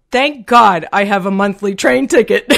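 amused, laugh building through the words; laughing retelling. Retold or imitated. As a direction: deliver the punchline on the edge of laughter. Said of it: "kind of laughing and crying".

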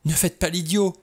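high, urgent plea; sharp, alarmed exclamation; crying out. A narrator's reading. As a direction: cry out to hold someone back. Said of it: "s'écria-t-elle".

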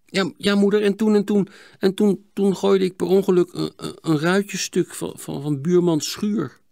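high, stammering admission; hesitant confession. Acted. Boy confesses broken pane to mother, stammering. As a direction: stumble nervously through a confession. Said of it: "vervolgde Sietse wat zachter".

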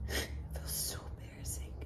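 soft tearful whisper; teary-eyed, embarrassed. Real, not acted. Vlogger embarrassed at crying on camera, whispered. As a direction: barely whisper it, embarrassed by the tears. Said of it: "my teary-eyed crying rant".

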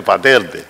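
"pa' Telde" said "pa Terde". Shown as a demonstration. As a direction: swap the L of "Telde" for an R, forcefully. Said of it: "no vamos con la R".

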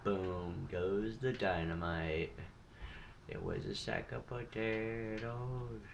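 slow, stretched-out words; drawn-out mock commentary. Gamer showing viewers a kill, drawn-out. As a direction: draw each word right out playfully.

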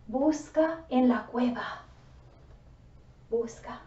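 normal talking voice

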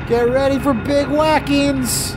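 squeaky cartoon warning; high cartoon voice. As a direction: warn like a cartoon goon. High put-on voice.

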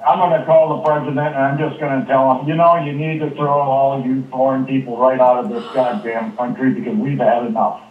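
ordinary talk

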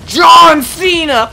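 very loud, high, stretched-out yell; hyped announcer shout. Pretend. Gamer answers his knock-knock joke, booming drawn-out announcer shout. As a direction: blast the name like a ring announcer.

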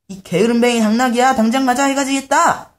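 high, loud scolding owner; shrill-free stern owner shouting; angry master. Acted. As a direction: yell at the lazy donkey. Owner scolding lazy donkey.

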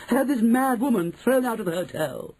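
high-pitched indignant woman; haughty fury, pitched very high; outraged demand. Acted. Mrs Jenkins demands eviction.

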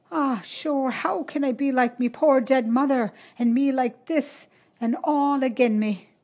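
put-on high, plaintive Irish girl's lament; lightly voiced weeping girl; tearful lament. A narrator's reading. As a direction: Sadie crying to Maura in labour, plaintive. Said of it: "sobbed Sadie".

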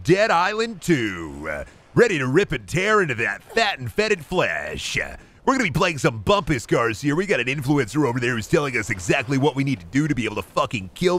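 high, hyped YouTuber intro; streamer persona. Pretend. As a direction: gush like an over-the-top streamer. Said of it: "Let me put on my streamer persona".

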